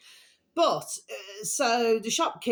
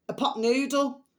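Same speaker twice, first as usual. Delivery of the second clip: normal talking voice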